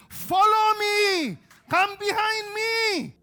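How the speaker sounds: very loud, high, slow command; commanding shout. Acted. Jesus commanding disciples, slow loud shout. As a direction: order them to follow.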